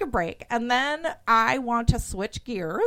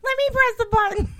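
put-on little kid voice; 14 semitones higher than usual. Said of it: "like the little kid who wants to press the button".

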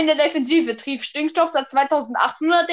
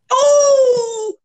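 high, loud delighted cry; excited oh; 8 semitones higher, 5 dB louder than usual. Real, not acted. Player yelps at a great card draw. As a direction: gasp with joy at the card.

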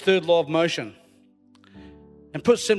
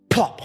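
sudden, loud popped plop; a punchy plop sound effect; imitated plop; 6 dB louder than usual. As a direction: pop the word like a splash. Imitating stone hitting the water.

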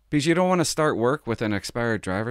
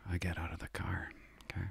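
hushed whisper; 3 semitones lower, 15 dB quieter than usual. Retold or imitated. Sneaking out past the cop.

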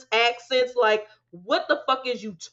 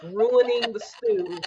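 ordinary talk, nothing marked

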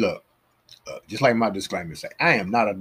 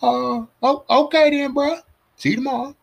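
mock casual farewell; 5 dB louder than usual. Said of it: "he's like".

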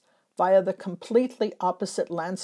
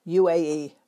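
usual speaking voice

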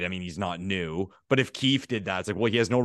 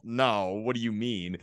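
ordinary talk

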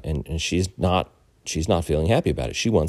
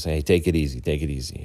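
normal talking voice

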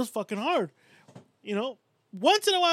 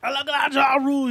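mock shout; 5 semitones higher, 7 dB louder than usual. Mocking the quoted boast.

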